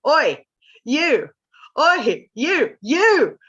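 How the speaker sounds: loud, high calls of "Oi, you"; a nagging inner voice yelling for attention; brain shouting. Retold or imitated. Brain knocking on the skull, calling out loudly. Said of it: "inside of your brain is knocking on your skull".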